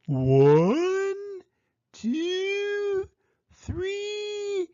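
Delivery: coyote countdown. A narrator's reading. Coyote starting race.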